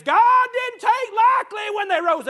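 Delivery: loud, high, drawn-out pulpit cry; real full-force preacher's shout; preaching shout. Real, not acted. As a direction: thunder the warning to the congregation, slowly. Preacher hammers home a warning.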